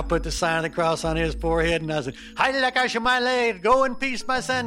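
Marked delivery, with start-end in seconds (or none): 2.3-4.7 much higher, hammed-up priestly chant of fake Latin; mock priest in Latin. Blessing the undercover agent as a mock priest, high and hammed-up. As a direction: play a priest blessing a panicked man in made-up Latin. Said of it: "doing my best imitation of, of a priest speaking in Latin".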